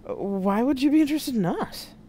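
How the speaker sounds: baffled, pitch climbing well up; stammered disbelief. A narrator's reading. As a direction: ask it thrown off balance. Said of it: "Georg stammered out".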